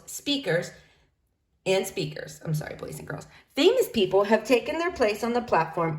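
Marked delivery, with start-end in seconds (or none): 2.4-3.3 soft whispered apology off the page; whispered aside; quick whispered aside. Real, not acted. Reader apologises aside to kids.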